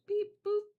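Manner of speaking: high squeaky beep boop; high playful beep boop; robot beeps. Pretend. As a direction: chirp like a little robot. Making noises while drawing.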